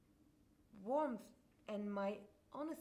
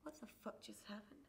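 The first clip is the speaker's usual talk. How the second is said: stunned quiet; 3 semitones lower, 11 dB quieter than usual. Retold or imitated. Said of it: "And I'm like".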